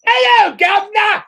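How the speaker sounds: high, loud mocking Cockney squeal. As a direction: shriek it like a Cockney flower girl. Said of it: "Audrey Hepburn going".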